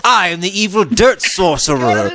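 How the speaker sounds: hammy evil sorcerer. Pretend. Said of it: "I'm like".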